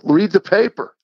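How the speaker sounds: exasperated, re-enacted justification; retold defensive retort. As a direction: argue it as if obvious. Defensive comeback to wife in retold quarrel.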